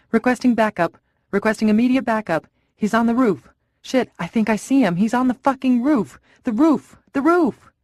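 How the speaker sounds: rising to a high, loud panicked yell; panicked shouting. Acted. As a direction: scream that he's on the roof. Panicked officer spots sniper.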